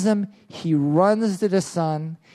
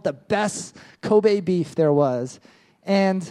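ordinary talk, nothing marked

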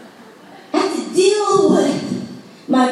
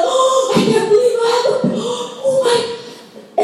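giddy excitement; 7 semitones higher than usual. Retold or imitated. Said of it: "That excitement of".

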